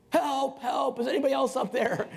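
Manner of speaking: high, loud cry up the cliff; hammed-up high-pitched yell for rescue; yelling for help. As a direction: call out desperately for anyone else. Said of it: "he's yelling for help".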